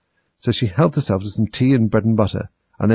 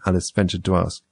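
ordinary delivery, nothing marked

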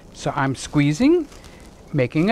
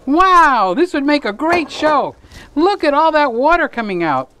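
excited; 7 semitones higher, 3 dB louder than usual. Real, not acted. Excited at the draining water.

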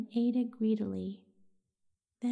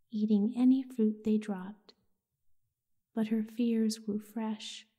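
ordinary delivery

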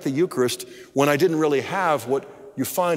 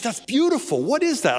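higher-pitched retold delight; excited; 9 semitones higher than usual. Wife hears husband read Vatican document, excited. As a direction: imitate a wife's excited, high-pitched wonder. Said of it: "And she got excited".

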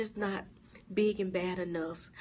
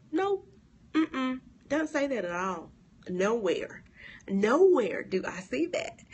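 louder, firm nope and nowhere; emphatic denial; 4 semitones higher, 5 dB louder than usual. Real, not acted. Preacher firmly rejecting the viewers' excuse. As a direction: shut the idea down firmly.

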